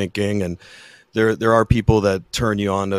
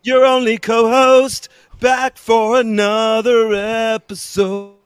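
high, slow grand entrance; hammy announcer; 12 semitones higher, 5 dB louder, slower than usual. Acted. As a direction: announce yourself theatrically. [name]'s hammed entrance.

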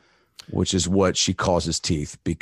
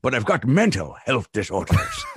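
lightly voiced pirate, higher at the end; a hint of a swaggering pirate; pirate boasting his mental health disorders; 5 semitones higher than usual. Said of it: "he's like".

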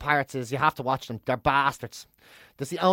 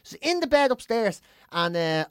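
ordinary delivery

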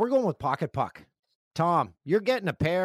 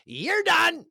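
high, loud punchy catchphrase yell; mock dismissal; 5 dB louder than usual. Host to all his own accents, mock dismissal shout. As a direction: shout it as a sudden, over-the-top sacking. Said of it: "Notice to all [name]'s accents".

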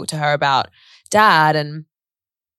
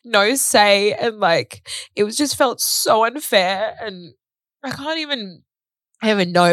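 real distress; 3 semitones higher than usual. Real, not acted. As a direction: struggle to get the words out through grief. Overwhelmed recalling father's death.

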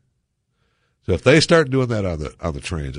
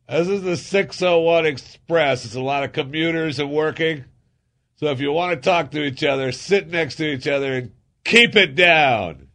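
put-on old man scolding; 8 semitones higher than usual. Old man across the aisle scolding the chatty couple, stern. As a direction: lecture them like a stern elderly commuter. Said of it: "he goes".

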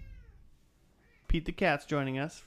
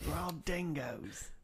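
laconic outback drawl; 3 semitones higher, 4 dB quieter, much slower than usual. Acted. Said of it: "Yeah, Australian?".

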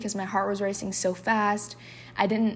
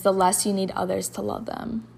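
ordinary talk

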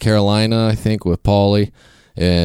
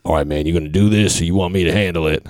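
bodyguard voice; 2 semitones lower, faster than usual. Retold or imitated. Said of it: "he's like".

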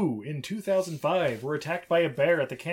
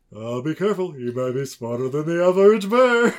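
a hint of cartoon bear, swelling louder at the end; light cartoon bear impression; 8 dB louder than usual. Joking about the bear's face in the movie, lightly voiced cartoon bear. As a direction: lightly put on a cartoon character voice.

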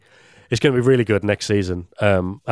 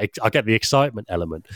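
ordinary delivery

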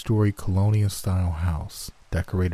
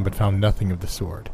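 plain reading voice

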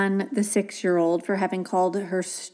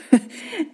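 laugh; 7 semitones higher than usual. Real, not acted. Laughing at the boy's lie.

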